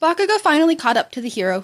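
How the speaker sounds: plain reading voice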